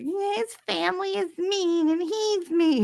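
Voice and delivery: high, slow mock whine; high, slow mocking imitation of the ex-wife. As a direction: mimic her complaining in a high, slow voice. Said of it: "she started like".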